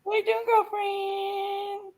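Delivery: high, very slow witchy croon; witch voice. Acted. Caller teases the host in a slow, high witch voice. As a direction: stretch it out like a wicked witch. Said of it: "you sound like a witch".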